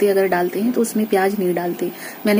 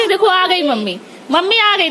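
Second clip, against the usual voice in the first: put-on baby talk; 12 semitones higher, 5 dB louder than usual. Mother soothing baby.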